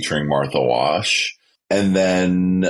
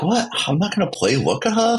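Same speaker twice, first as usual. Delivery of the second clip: outraged disbelief; 13 semitones higher, faster than usual. Retold or imitated. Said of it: "they would be like".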